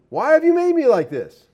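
high, whining voice of the clay; a pot complaining to its maker; whiny clay pot. Acted. Clay jar whining at the potter, high and petulant.

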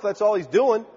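ordinary talk

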